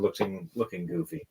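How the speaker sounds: ordinary talk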